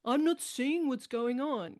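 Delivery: mock petulant husband; petulant child attitude. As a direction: sulk like a defensive teenager. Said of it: "adolescent kind of petulant child attitude".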